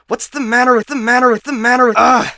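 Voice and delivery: high, loud glitching loop breaking into a yell; frustrated outburst. Acted. AI unable to recall serial number, shouting in frustration. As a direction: explode at your own failing mind.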